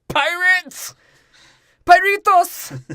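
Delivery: very high, loud cry of alarm; hammed-up shriek to raise the alert; mock alarm shout. Spanish soldier spotting pirates, high mock alarm shout.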